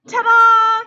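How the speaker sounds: loud, high showman's cry; grand reveal. Acted. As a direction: present yourself proudly.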